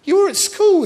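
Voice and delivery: high, coaxing wheedle to a grown man. Retold or imitated. Teasing a shy audience member, high coaxing. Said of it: "And I went".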